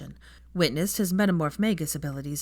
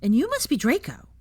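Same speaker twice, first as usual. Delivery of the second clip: put-on eager child, higher and louder; cheerful guess at a new friend; bright little boy voice; 8 semitones higher, 5 dB louder than usual. A narrator's reading. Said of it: "said Teddy brightly".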